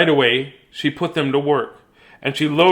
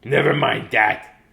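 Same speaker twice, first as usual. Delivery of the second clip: mild snap; 4 dB louder, slower than usual. Acted. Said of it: "snapped Miss Swamp".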